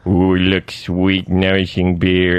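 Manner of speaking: deep, savouring cartoon voice; low and slow, relishing each word; Homer Simpson. Comic comparing two characters, low slow Homer voice. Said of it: "So you go, Homer Simpson".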